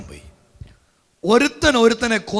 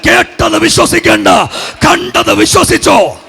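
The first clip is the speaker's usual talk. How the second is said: preaching that climbs higher and more forceful; building exhortation; 5 semitones higher, 10 dB louder than usual. Real, not acted. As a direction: urge them on, each phrase more insistent.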